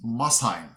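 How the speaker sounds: Mosheim said MUSS-hyme; real pronunciation. Shown as a demonstration. Correcting himself on the city, Mosheim as MUSS-hyme. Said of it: "but it's".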